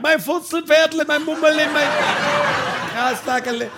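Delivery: high, put-on lovey-dovey voice; lovestruck neighbour. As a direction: squeal pet names like a smitten lover. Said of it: "der Nachbar ist frisch verliebt".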